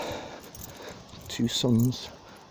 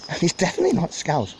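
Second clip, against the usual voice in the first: usual speaking voice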